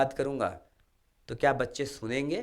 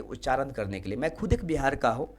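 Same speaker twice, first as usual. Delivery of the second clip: ordinary talk, nothing marked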